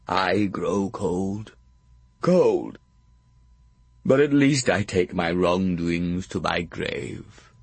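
slow, fading dying lord; dying old earl. A narrator's reading. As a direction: let the words die out slowly. Gloucester dying, growing cold.

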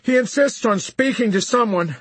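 high, loud, anxious plea; urgent, nervous sergeant; nervous pleading. A narrator's reading. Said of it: "pleaded the sergeant nervously".

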